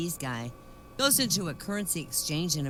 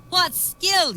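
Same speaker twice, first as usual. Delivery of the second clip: higher, louder outraged yell; furious yell; 14 semitones higher, 10 dB louder than usual. Retold or imitated. Ole yelling at the clerk over his pay, furious. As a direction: bellow it at the clerk. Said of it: "yells Ole".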